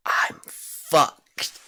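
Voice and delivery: imitating a drunk teen's sudden dread; panicked. Panicking after drinking too much.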